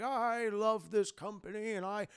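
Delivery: put-on slow, soft, gentle old boss voice; imitating a kindly old boss hiding his evil; kindly old man. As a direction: coo it like a sweet old company man. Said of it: "he's this kindly old man".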